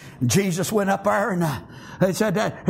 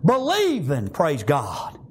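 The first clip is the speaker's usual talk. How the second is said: fervent preaching; 7 semitones higher than usual. Real, not acted. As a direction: preach it with conviction to the congregation. Preaching with force.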